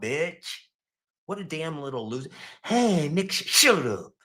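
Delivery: ordinary delivery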